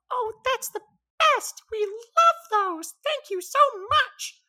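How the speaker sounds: high, loud chirpy bird voice; tiny bird bursting with delight; excited little bird. Acted. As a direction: squeal it like a thrilled tiny bird.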